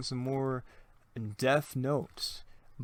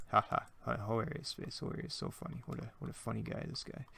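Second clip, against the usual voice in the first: deadpan mock praise, trailing softer; sarcastic; 2 semitones lower, 9 dB quieter than usual. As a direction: mutter fake amusement at your own bad joke. Mocks own bad pun as viewer.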